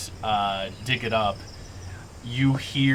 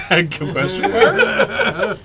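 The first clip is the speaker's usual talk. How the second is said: amused, through laughter; laughing; 5 semitones higher, 4 dB louder, slower than usual. Real, not acted. Game master teasing a player's threat, laughing. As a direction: laugh while drawing the words out slowly.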